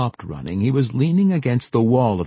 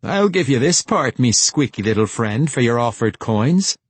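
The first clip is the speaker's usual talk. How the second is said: rowdy pirate woman, pitched up at first; 3 semitones higher, 3 dB louder than usual. A narrator's reading. Sheila Cree taunting Josie with the warhammer, rowdy. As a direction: throw it out like a boisterous pirate. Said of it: "the rowdy Sheila Cree said".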